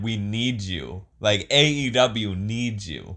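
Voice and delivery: slow plea. Retold or imitated. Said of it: "He said".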